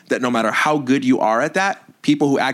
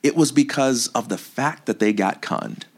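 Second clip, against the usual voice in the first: ordinary delivery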